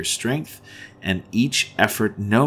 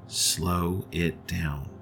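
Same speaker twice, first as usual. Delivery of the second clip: slower, softer; 2 semitones lower, 8 dB quieter, much slower than usual. Shown as a demonstration.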